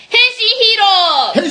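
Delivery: high, rousing announcement; title call. Acted. Host announcing the transforming-hero segment, high rousing call.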